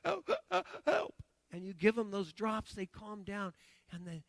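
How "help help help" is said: high, loud pleading cries of help; a dying patient gasping for help; desperate cry for help. Retold or imitated. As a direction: beg for air, voice cracking high. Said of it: "they're crying to you".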